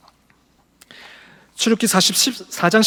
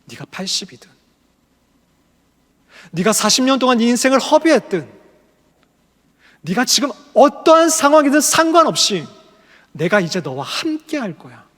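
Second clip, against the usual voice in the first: emphatic proclamation; 5 semitones higher, 6 dB louder than usual. Acted. Preacher proclaims God's words.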